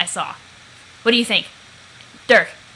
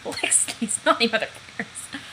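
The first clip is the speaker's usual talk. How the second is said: laughing whisper; 6 dB quieter than usual. Acted. Showing off his muscles.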